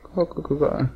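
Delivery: imitando uma galinha, leve; có có curtinho de brincadeira; cacarejo de galinha. Pretend. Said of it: "eu sou uma galinha". (cacarejo de galinha de brincadeira)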